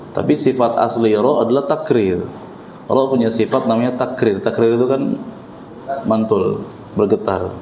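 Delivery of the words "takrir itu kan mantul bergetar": ordinary talk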